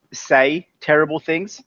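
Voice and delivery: ordinary delivery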